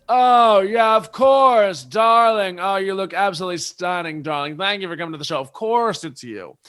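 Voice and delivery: Countess Lou. Retold or imitated. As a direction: open loud and high, then settle into stretched flattery. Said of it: "And Lou just goes".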